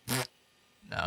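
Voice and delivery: normal talking voice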